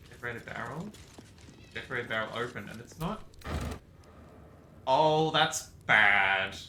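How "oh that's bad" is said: slow, loud drawn-out oh that's bad; dismayed drawl. Real, not acted. Gamer dismayed at bad loot, slow and loud. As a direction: let the dismay stretch out. Barrel result goes wrong.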